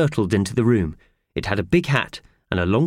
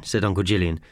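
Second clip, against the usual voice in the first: ordinary delivery, nothing marked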